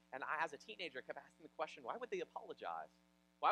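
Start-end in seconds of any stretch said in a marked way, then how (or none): none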